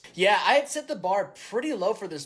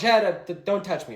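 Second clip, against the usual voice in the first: ordinary talk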